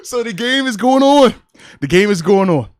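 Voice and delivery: high, loud, laugh-strained voice; through laughter. Real, not acted. Dad resuming his game story, struggling with laughter. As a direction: push the words out after cracking up.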